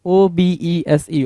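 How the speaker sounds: slowly, one letter at a time; spelling out. Shown as a demonstration. Teacher spelling a vocabulary word for students, slowly letter by letter.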